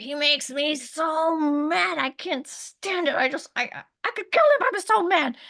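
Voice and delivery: shrill-free mock fury, rising high; retold anger. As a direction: vent about the ex-husband like back then. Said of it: "very tumultuous divorce".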